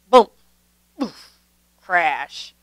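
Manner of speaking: ordinary delivery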